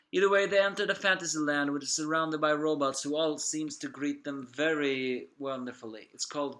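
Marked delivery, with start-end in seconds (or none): none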